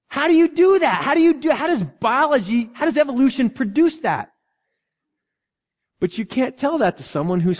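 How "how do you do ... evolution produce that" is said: high, emphatic rhetorical amazement; amazed questioning. Real, not acted. Preacher asking church how nature does this, amazed. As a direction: marvel aloud, pitch jumping up.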